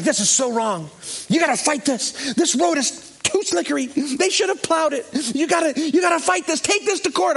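high, worked-up mock outrage; imitated indignant protest. Cop urging pastor to fight ticket, mock outrage.

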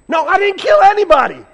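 very high, very loud whoop; mock victory whoop. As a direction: cheer as if you just won. Mocking ruler's self-congratulation.

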